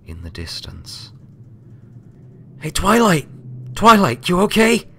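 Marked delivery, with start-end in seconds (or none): 2.6-4.9 Rainbow Dash, high and loud, calling out to her friend; concerned call. A narrator's reading. Rainbow calling to Twilight after the rescue, high concerned shout. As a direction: shout her name, worried but brash. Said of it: "looking at her friend in concern".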